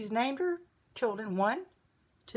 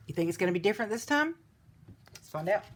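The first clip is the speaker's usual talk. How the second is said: plain reading voice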